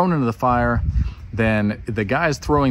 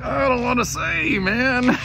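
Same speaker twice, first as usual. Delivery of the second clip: high, whiny dodge of the question; reluctant Daniel; 13 semitones higher, slower than usual. Acted. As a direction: squirm out of answering in a high voice. Daniel reluctant to tell dream.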